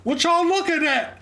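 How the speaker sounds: very high, loud, drawn-out shout; hammed-up challenge to nosy onlookers; mock confrontational yell. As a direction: play the public troublemaker, stretched and loud. Acting out man confronting onlookers.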